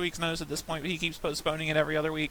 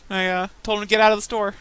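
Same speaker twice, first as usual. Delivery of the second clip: ordinary talk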